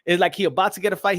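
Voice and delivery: normal talking voice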